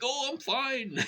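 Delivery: very high forced cheer; fake cheerful reply. Faking cheer to the clerk while in pain. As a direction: pretend all is well. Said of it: "I'm like".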